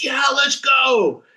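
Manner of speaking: high, drawn-out shout of stage excitement; excited shout. Retold or imitated. Drummer recalling the stage rush, fired-up shout. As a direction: let it rip like stepping on stage.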